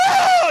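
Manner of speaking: loud, very high comic wail; imitated scream of a punched kid; mock cry of pain. Kid just socked in the face, exaggerated wail.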